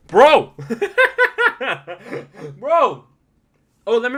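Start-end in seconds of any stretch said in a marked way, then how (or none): 0.1-0.5 a loud, high "Bro!" bursting out; amazed shout. Real, not acted. Amazed outburst about Conor. 0.6-3.0 a high, loud "Bro!" with a laugh. Real, not acted. Amazed outburst, laughing.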